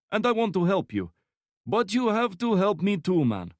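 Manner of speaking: put-on high, loud menacing Slavic accent; cheerful threat, thick Slavic accent; heavy Slavic accent. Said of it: "speaking in a heavy Slavic accent".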